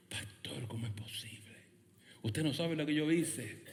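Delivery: whispered, incredulous question to the pastor; acted troubled churchgoer, very softly; hushed confession. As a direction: drop to a whisper, as if ashamed. Acts a troubled member asking pastor.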